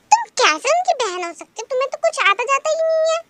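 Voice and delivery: high, mocking scold; scolding teacher. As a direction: belittle the lazy student. (ऊँची, ताना मारती डाँट)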